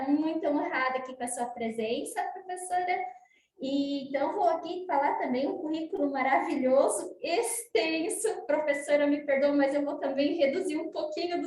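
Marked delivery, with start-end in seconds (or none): none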